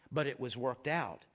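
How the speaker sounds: ordinary delivery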